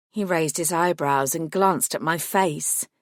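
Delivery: usual speaking voice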